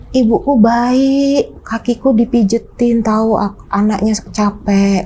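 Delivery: put-on slow, dreamy old man; father dazed. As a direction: drawl it slowly as if entranced. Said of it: "bokap bilang kayak gini".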